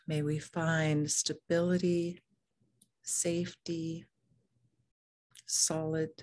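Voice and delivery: soft, unhurried meditation voice with long pauses; calm, even meditation leading; guided meditation. Real, not acted. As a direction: guide them gently into the body, leaving room to breathe.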